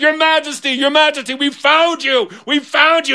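high, breathless-with-news cries; frantic relief. Acted. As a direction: call it out high, overexcited guards. Guards rush to their lost king.

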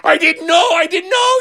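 very high, wailing plea; panicked apology. Acted. Beggar panics before the king.

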